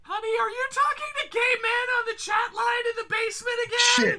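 high nagging wife shout; shrill yelling wife. Acted. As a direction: yell down the stairs like a wife. Mock wife yelling from upstairs.

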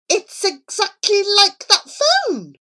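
high-pitched, strained exclamation; exasperated. Acted. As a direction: point out the obvious in agitation. Charlie exasperated at the phone.

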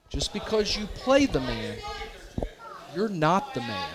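mock dead serious. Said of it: "as serious as he can be".

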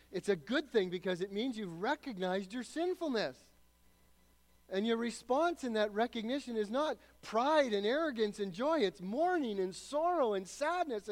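loud, high, driving sermon voice; fiery pulpit preaching; full-force preaching. Acted. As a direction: preach it out to the whole hall.

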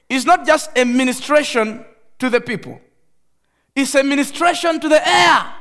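loud, rising preacher's cry; fervent sermon peak; preaching at full force. Acted. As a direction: proclaim it to the air.

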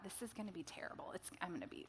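very soft, quick worried thought; muttered dread. Retold or imitated. Dreading her first run back after being sick, muttered. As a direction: mutter it hurriedly under your breath.

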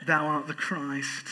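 slow, dramatic English accent; put-on English accent, drawn out for drama. Shown as a demonstration. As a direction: intone it like an English stage actor. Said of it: "say it with an English accent".

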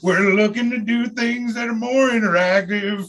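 high, slow announcer pitch; promo read. Acted. Host reading a plug to listeners, announcer style. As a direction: sell it like a station promo.

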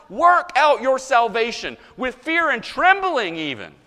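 loud, high, indignant hammering on "work"; acted hectoring outburst, pitched high; insistent objector. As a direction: shout the proof text at a blind opponent. Acting indignant objector hammering the verse.

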